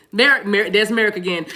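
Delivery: ordinary delivery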